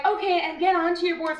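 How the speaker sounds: high, singsong class-leader voice; chirpy instructor. Retold or imitated. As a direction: imitate a perky trainer giving orders. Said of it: "they're like".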